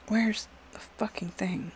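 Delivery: whispered under breath; hushed frustrated whisper. Real, not acted. Muttering while searching the settings.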